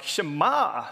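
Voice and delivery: Shema drawn out in a Georgia drawl; Georgia accent. Shown as a demonstration. Preacher correcting his Kiwi pronunciation, mock Georgia accent. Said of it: "like I'm from Georgia".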